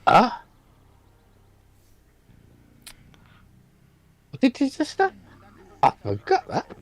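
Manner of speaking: high, squeaky disbelieving gasp; shocked. Retold or imitated. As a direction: retell a scandalised double take. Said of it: "I was like".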